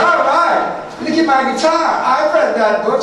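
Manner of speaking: high-pitched mock angry huff; hammed-up indignant woman; mad woman, imitated. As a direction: imitate her storming off in a huff. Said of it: "Man, she's mad".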